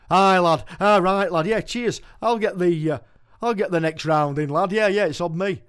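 loud, hearty Yorkshire bloke at the bar; cheerful pub character offering a round; old pub regular. Acted. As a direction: boom it out like a chummy regular. Pub regular voiced in poem.

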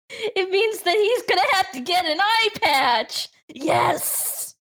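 high-pitched delight, ending in a whispery yes; gleeful excitement. Real, not acted. Player delighted her character needs an eye patch, gleeful.

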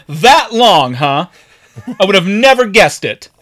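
imitated loud sarcasm; sarcastic cop. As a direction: jeer at the driver in fake amazement. Cop sarcastically mocks the driver.

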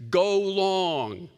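a loud coach call; coach's call. Retold or imitated. Pastor playing Coach Jesus calling a play, loud call. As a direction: call it like a coach sending a pass. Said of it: "Coach Jesus says".

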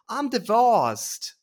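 loud, slow, high mocking delivery; deadpan punchline, dragged out. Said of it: "every joke is just".

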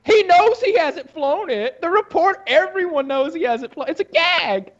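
very high-pitched, incredulous protest; exasperated insistence. Real, not acted. Co-host arguing the helicopter is a joke, exasperated. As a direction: push the voice way up to insist. Insisting over co-host's argument.

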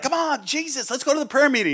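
mock naive enthusiasm; eager young zealot. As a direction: chirp it like an overexcited kid. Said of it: "they're like".

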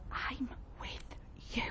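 soft unvoiced whisper. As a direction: breathe it intimately. Whispered words of God.

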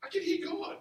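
high-pitched eager announcement; retold excitement. Young man telling his upset pastor friend, excitedly. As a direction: blurt it out high, thrilled. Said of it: "But I got excited".

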